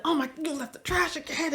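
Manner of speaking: nagging parent. Retold or imitated. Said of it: "Instead of me coming".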